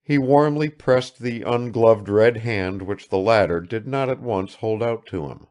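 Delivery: plain reading voice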